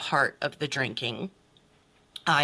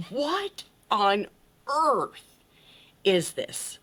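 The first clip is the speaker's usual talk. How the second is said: bewildered; 7 semitones higher, much slower than usual. Retold or imitated. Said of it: "I'm sitting there and I'm like".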